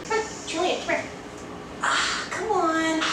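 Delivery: soft, high coaxing; coaxing a pet. Real, not acted. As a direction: coax the cat down gently. Coaxing cat down.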